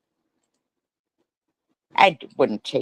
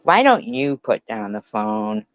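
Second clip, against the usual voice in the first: ordinary delivery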